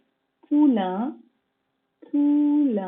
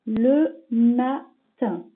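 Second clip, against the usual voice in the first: very slow, "Le matin" stretched out syllable by syllable; slow, deliberate dictation voice; much slower than usual. Shown as a demonstration. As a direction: dictate "Le matin" slowly for a young pupil. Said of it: "dictation, word stretched slowly".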